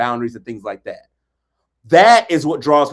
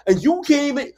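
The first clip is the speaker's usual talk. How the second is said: ordinary talk, nothing marked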